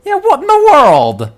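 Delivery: loud, very high cry of disbelief; high exclamation. Real, not acted. Player reacting to what just happened in the game, loudly astonished.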